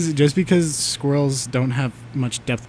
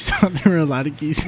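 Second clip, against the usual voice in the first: ordinary talk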